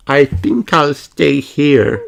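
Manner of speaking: put-on a hesitant small girl, hanging back; Peppa. A narrator's reading. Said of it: "says Peppa".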